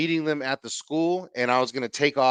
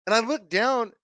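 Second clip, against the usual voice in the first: ordinary talk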